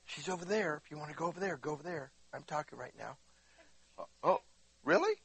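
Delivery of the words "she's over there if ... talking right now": soft, lowered aside off the sermon; quiet aside. Real, not acted. Preacher pauses to direct someone over there, quiet aside. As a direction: drop your voice for a quick side word.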